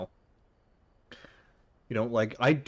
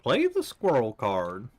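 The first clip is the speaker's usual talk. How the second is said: ominous game master voice; 3 semitones higher, slower than usual. Acted. Reads game character's instructions.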